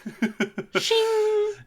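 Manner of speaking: high, ringing "shing" noise; sound effect of blades. Pretend. Player adds the knife sound effect, ringing mouth noise. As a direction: imitate a drawn blade with your mouth. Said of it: "both of my sound effect noises".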